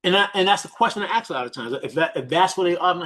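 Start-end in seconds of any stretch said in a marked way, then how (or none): none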